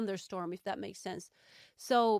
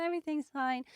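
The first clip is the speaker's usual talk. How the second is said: forced little laugh, higher pretend-happy voice; fake cheerfulness; 5 semitones higher than usual. Answering worried people while pretending, fake cheerfulness. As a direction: put on a yellow smile, badly hiding the truth. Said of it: "like, that yellow smile".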